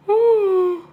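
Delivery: big weary sigh. Real, not acted. Tired, yawning on live stream.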